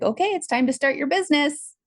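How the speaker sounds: high-pitched imitation of a cheery prompt; universe's nudge. Voices the universe giving an order.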